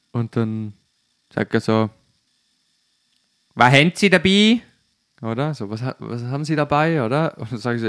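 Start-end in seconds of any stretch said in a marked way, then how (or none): 3.5-4.6 loud, high Swiss-dialect question; Swiss officer's demand. Retold or imitated. Swiss customs officer questioning the driver, loud dialect demand.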